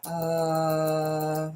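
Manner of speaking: long steady low drone; playful droning vowel; talking-into-a-fan noise. Shown as a demonstration. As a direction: hold a monotone "uh" as if into a fan. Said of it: "that kind of stuff in front of the fan".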